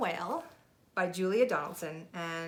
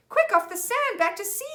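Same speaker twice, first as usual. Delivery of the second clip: much higher, louder panicked squeak; urgent cry; 10 dB louder than usual. Acted. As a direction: cry out in alarm to save the whale. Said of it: "cried the snail".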